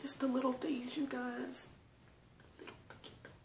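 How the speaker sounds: real whisper, fading to almost nothing; emotional whisper. Real, not acted. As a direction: whisper it, overcome, letting it die away. Overcome with emotion, voice drops away.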